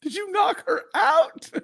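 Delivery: high shrieking disbelief; shocked outrage. Pretend. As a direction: squeal it in mock horror. Outraged at child knocking lady.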